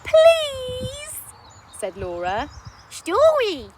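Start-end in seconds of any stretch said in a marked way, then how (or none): 0.1-1.2 very high, loud drawn-out please; begging child. Acted. 2.9-3.7 put-on high, loud toddler word; baby voice. Baby asking.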